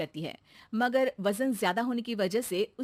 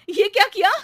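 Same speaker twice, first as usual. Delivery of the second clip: loud, high, furious yell at the maid; sharp angry outburst; angry shout; 9 semitones higher, 11 dB louder than usual. Acted. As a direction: scold her for the mess. Anuradha finds scattered wheat.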